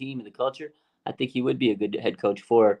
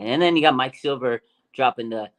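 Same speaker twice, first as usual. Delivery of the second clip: ordinary talk, nothing marked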